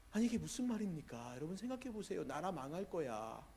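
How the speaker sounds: usual speaking voice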